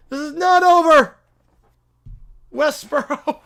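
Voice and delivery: pushy self-proclaimed prophet bellowing; loud holy crusader voice; zealous preacher. Acted. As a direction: barge in demanding converts. Preacher storms off vowing.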